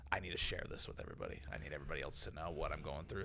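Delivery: low, hushed, quick quote; quietly voiced thought. Host voicing his friend's thought in hardship, low and quiet. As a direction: say it low and soft, like thinking aloud.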